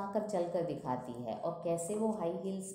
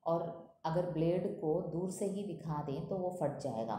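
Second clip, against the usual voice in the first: normal talking voice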